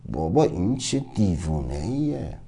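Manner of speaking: low, hushed disbelief; stunned aside. Acted. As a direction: say it under your breath, taken aback. Stunned world mutters about him.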